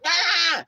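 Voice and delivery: loud, very high mock shriek; short piercing imitation screech; imitated scream. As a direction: scream like an enraged woman, hammed-up. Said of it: "she'll start screaming at me".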